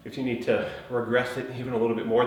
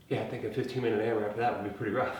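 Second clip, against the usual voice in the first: ordinary talk, nothing marked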